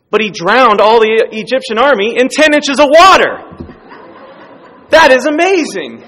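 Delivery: loud, high laughing through the words; laughing triumph. Retold or imitated. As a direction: gloat with a laugh. Kid laughing triumphantly at professor.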